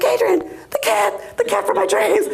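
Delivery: gushing loud call about the cat; excited. Retold or imitated. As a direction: call out to him, over the moon about the cat. Calling husband about the cat.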